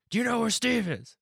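high-pitched mock shout; yelling. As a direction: imitate a man shouting his question from the street. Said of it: "He just kept yelling".

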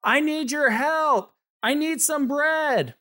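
high, drawn-out call for help; neighbor yelling. A narrator's reading. Neighbor calls through the door at midnight, high drawn-out yell. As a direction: yell it slowly, pitched well up. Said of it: "muffled sound of his neighbor through the door yelling".

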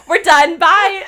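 loud, high-pitched kiss-off; exaggerated, emphatic goodbye; mock dismissal. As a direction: call it off loudly with a laugh. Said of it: "She's like".

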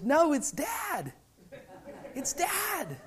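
put-on high, drawn-out small child's voice; imitated little boy. Son correcting his sister mid-flight, imitated child's voice. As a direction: pipe up like a little kid. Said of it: "Lincoln is laughing, and he says".

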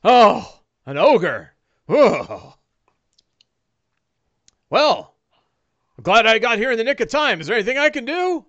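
high, loud, eager character voice; Don John. Acted. Latecomer offering help after the fight, high loud voice. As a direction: blurt it out, quickening into the offer. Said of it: "Don John".

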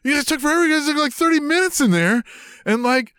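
high-pitched whiny outrage, voicing the radio guy; angry complaint. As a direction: squeal it like an upset DJ. Angry radio host complaining to them.

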